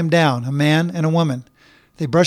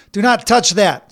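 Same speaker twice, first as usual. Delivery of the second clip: sharp, higher and firm; sharp warning; 6 semitones higher, 4 dB louder than usual. Acted. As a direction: snap a quick warning. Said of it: "said Bobby sharply".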